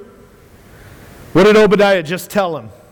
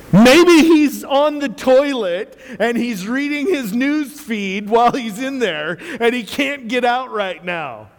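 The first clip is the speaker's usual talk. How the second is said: ordinary talk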